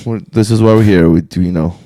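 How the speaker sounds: normal talking voice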